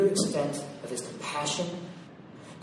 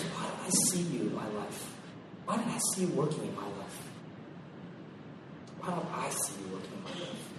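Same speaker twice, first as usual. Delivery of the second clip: softer, sinking plea; soft longing; 8 semitones lower, 8 dB quieter than usual. Acted. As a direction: ask it quietly, searching. Voicing a longing question.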